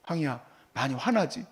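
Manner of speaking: soft, low, tender question to a sobbing son; gentle comforting. Retold or imitated. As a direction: ease his anger gently. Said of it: "혼내지 않고 이렇게 했어요". (아이를 달래듯 부드럽게)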